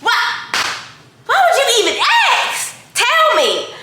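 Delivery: high-pitched squeal of disbelief, ending in a laugh; inner excited scream. Retold or imitated. A star asks her to do her hair for the show, and she screams with joy inside. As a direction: shriek it like you can't believe your luck. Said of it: "I just wanted to be like".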